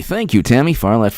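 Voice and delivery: usual speaking voice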